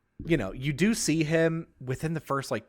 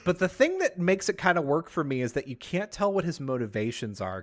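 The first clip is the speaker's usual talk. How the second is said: ordinary talk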